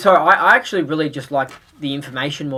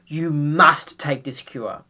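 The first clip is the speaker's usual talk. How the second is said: slow, firm, pressing on must; slow insistence; much slower than usual. Pretend. Holding the cure to a dying viewer, slow and firm. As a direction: urge it word by word.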